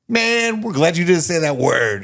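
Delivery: high, loud mimicked relief; imitated relief. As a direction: voice the family's emphatic relief. Acting out family's relieved reaction.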